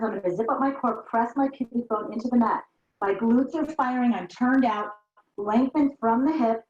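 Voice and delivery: ordinary delivery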